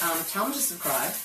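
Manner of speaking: talking with sticky teeth; words blocked by sticky caramel; caramel stuck in teeth. Real, not acted. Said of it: "I couldn't say subscribe because I had caramel stuck in my teeth".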